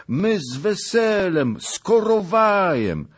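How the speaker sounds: put-on high-pitched, pleading old peasant; humble begging. A narrator's reading. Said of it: "a błagać". (błagalny, pokorny głos starca)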